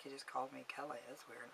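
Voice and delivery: very quiet, low aside; private aside. Retold or imitated. Caller privately puzzled by being called Kelly, quiet aside. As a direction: mutter it to yourself under your breath. Private thought during a retold phone call.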